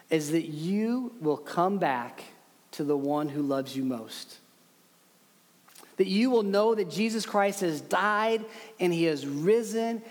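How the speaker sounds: ordinary talk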